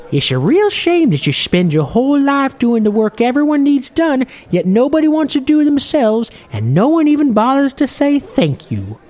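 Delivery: put-on old fisherman. Old captain grumbling to Morwell at the bar, in a high loud old-man voice.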